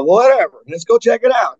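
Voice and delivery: high, loud shrugging fan voice; mock casual fan. Fan agrees to see the band, shrugging mock voice. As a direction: toss it off like a bored fan.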